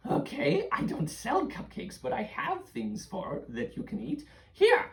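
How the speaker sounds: put-on high old hag. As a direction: croon like a witch offering food. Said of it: "the old hag is like".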